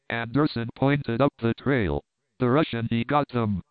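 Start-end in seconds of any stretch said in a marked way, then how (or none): none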